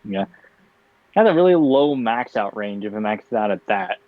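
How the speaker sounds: ordinary delivery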